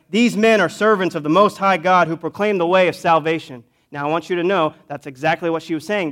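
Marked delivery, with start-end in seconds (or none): 0.1-3.6 raised, louder proclaiming cry; retold yelling. Slave girl calling out after Paul, imitated yell. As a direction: yell it out higher, in the preacher's own voice. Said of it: "she keeps yelling out".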